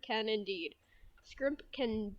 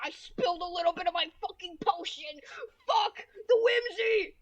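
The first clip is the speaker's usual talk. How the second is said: high, loud outburst; anguished comic shout; 9 semitones higher, 7 dB louder than usual. Real, not acted. Streamer spilled the potion, high dramatic yelling. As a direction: cry out over a spill, building to a yell.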